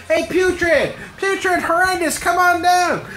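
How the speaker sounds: high, loud call across the yard; mock hillbilly call. Dad hollering for his made-up daughters, loud and high. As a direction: holler for the kids to come down.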